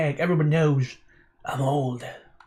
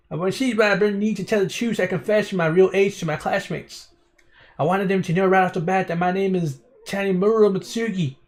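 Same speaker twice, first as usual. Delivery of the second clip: put-on drifting country accent; 4 semitones higher than usual. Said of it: "what this accent's turning into".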